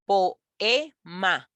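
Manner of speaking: "Poema" in three beats, po, e, ma; "Poema" broken up syllable by syllable; split into syllables. Shown as a demonstration. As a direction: leave the e of "Poema" standing alone between pauses. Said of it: "que se separan de sílabas".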